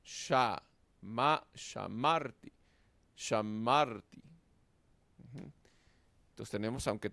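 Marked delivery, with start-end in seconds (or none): 0.0-4.2 "Sha, ma" split slowly, then "shamarti" leaning on "mar"; slow teacher demo stressing "mar" in "shamarti"; stress on second syllable. Shown as a demonstration. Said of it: "llevará el acento en la segunda sílaba".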